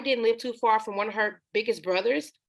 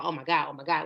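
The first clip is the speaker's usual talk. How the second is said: normal talking voice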